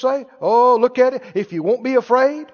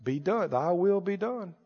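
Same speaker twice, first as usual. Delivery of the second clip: pious objector; 6 semitones lower than usual. Acted. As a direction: drop into a meek, cautioning religious voice. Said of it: "they're taught not to talk like that".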